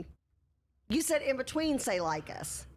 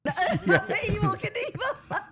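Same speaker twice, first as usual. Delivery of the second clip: laughing; 10 semitones higher, 3 dB louder, slower than usual. Pretend. Laughing mock announcer.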